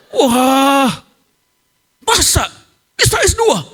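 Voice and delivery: high-pitched astonished exclamation; acted disbelief. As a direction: imitate incredulous listeners, voice shooting up. Pastors react in disbelief. (seruan kaget tidak percaya)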